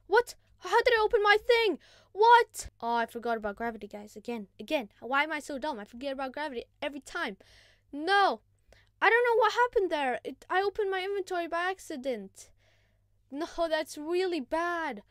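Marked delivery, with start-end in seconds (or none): 0.1-2.7 high, loud startled cries; loud baffled shouts; shocked outburst. Real, not acted. Inventory opens by accident. 7.9-15.0 higher, louder flustered protest; loud bewildered complaint; flustered dismay. Real, not acted. Inventory opened by accident.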